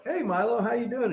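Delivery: high-pitched pet-greeting voice; imitated high greeting to a pet; talking to cat. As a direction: coo a high hello to a cat. Greeting the cat in a retold story.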